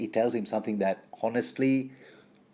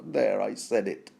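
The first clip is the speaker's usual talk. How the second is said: ordinary talk, nothing marked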